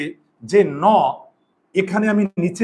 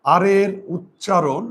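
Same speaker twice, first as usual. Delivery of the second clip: drawn-out, deliberate teacher delivery; slow teaching emphasis; much slower than usual. Shown as a demonstration. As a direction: spell out আর এর উচ্চারণ slowly, word by word. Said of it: "শব্দে". (ধীরে ধীরে জোর দিয়ে শেখানো)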